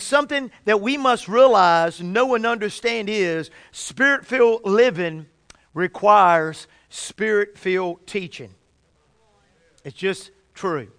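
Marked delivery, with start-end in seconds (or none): 5.7-8.5 slow, spaced-out phrasing; unhurried and deliberate, with weighty pauses; taking his time. Real, not acted. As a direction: let each word sink in, slowly. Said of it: "just taking my time there".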